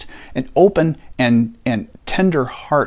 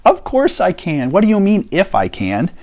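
indignant Jesus; 6 semitones higher than usual. Retold or imitated. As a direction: act offended that he was doubted. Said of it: "Jesus looks at him indignantly and goes".

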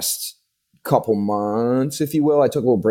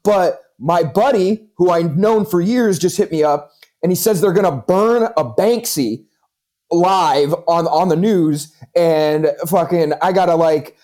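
put-on Jersey accent; 6 semitones higher, 3 dB louder than usual. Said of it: "thick Jersey accent".

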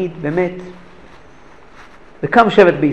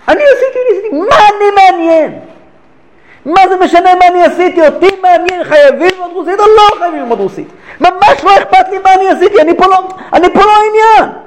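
much higher and louder, crying out that he is not the point; impassioned reply; 12 semitones higher, 6 dB louder than usual. Retold or imitated. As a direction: burst out fervently. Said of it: "אמר לו הרב רש"ב".

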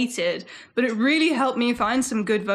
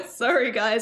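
usual speaking voice

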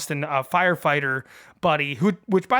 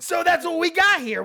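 higher, fired-up yell; worked-up yelling; 10 semitones higher than usual. Real, not acted. Host gets carried away after his movie-quote impression, yelling. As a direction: shout it, all worked up. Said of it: "I'm yelling".

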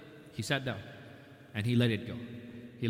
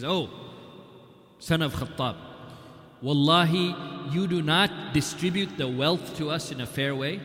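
loud, slow, higher accusing voice; rude accusation; 3 semitones higher, 4 dB louder than usual. Retold or imitated. Uyayna accusing Umar of unfairness, loud and indignant. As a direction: hurl the charge of injustice at him. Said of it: "He doesn't address him with respect".

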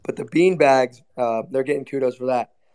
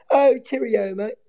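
high-pitched mock British greeting; mocking British accent; 8 semitones higher, slower than usual. Host imitating the guest's stock phrase, in high mock British accent. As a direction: mimic him in a squeaky put-on British accent. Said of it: "he knows, like, two phrases".

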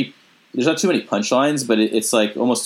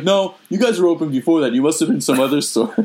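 dad voice; 6 semitones higher than usual. Retold or imitated. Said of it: "He was like".

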